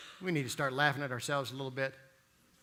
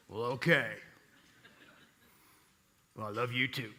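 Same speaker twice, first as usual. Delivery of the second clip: amused low buddy voice; 5 semitones lower, 3 dB quieter than usual. Retold or imitated.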